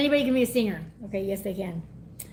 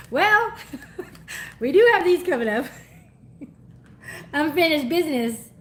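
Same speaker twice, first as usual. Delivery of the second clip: giggling; 4 semitones higher, 3 dB louder than usual. Real, not acted. Giggling over the reading.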